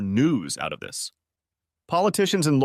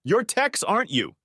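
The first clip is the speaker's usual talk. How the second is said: yelling; 11 semitones higher than usual. A narrator's reading. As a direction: call him out in a raised voice. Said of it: "he was still yelling".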